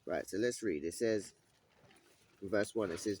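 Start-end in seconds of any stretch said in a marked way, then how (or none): none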